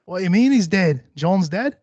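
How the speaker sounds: put-on Australian astonishment; mock surprise. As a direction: play innocent, acting shocked by the news. Acts feigned surprise at friend's death.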